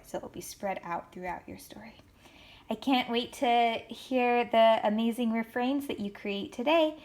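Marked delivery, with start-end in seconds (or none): 0.1-2.0 whisper. Real, not acted. Aside to students.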